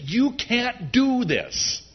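high, drawn-out, falling cry; emphatic declaration. Acted. Preacher voicing the impossibility of their situation, emphatic declaration. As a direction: stretch each word out high. Voices the lesson of the walls.

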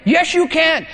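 mock high insistence; insisting. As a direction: insist back like a playground argument. Acted insisting friend.

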